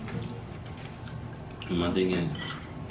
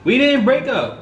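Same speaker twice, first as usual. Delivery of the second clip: high-pitched, insistent denial; sharp, disbelieving correction; indignant protest; 12 semitones higher than usual. Real, not acted. Husband disputes her account.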